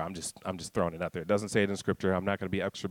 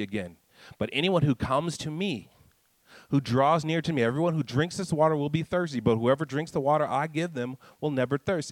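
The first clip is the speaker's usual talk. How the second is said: rising, higher preaching build; 5 semitones higher than usual. Acted. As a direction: preach it, climbing in pitch and intensity. Preaching the point.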